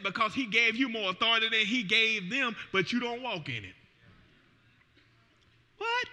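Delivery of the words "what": a sudden squeaky high "what"; mock surprise. Pastor voicing a stunned listener, squeaky high surprise. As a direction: play the shocked churchgoer.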